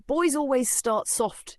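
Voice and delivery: slow, high taunting voice; lightly voiced sinister librarian; villain. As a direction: savour each word like a threat. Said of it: "she says".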